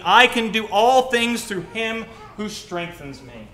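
loud, ringing preaching of the verse; scripture proclaimed. Acted. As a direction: proclaim the promise at full force.

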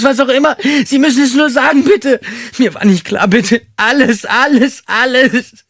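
very high, loud, frantic begging; woman pleading with the gunman, frantic and very high; desperate pleading. A narrator's reading. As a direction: beg for your life in panic. Terrified woman begs for her life.

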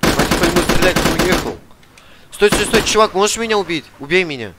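ordinary talk, nothing marked

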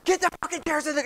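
high-pitched barked order; urgent, high, strained yell. Shown as a demonstration. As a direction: yell to get a room moving fast. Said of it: "But if I started yelling".

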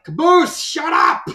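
loud, high-pitched mock shout; acted-out burst of annoyance at full volume; exasperated yell. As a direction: yell at an annoying teammate to shut him up. Acts out Church snapping at Caboose.

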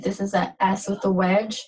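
ordinary delivery

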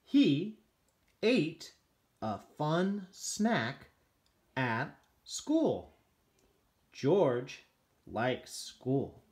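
slow, word-by-word reading for a beginner; slow read-aloud. Shown as a demonstration. Reading a picture book to a young child, slow and word by word. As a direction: read each word slowly, as if tracing it with a finger.

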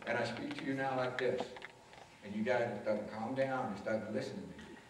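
low, soft, calming tone; mellowed out. Shown as a demonstration. Teacher setting a calm tone for students, mellowed out. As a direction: bring it down soft and low to settle them. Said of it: "if you're mellowed out".

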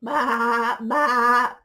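loud sheep bleat. Acted. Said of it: "bleated the sheep".

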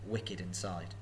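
ordinary delivery, nothing marked

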